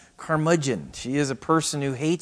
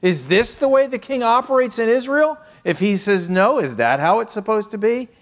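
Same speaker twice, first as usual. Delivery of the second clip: high, louder mocking queen; taunting Jezebel; 9 semitones higher, 5 dB louder than usual. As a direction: needle him, high and scornful.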